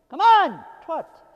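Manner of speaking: loud, high commanding shout on come on; German voice. Real, not acted. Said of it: "Think that was my German voice".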